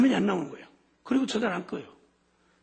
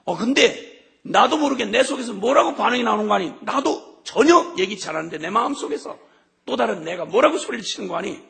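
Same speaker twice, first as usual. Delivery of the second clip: ordinary talk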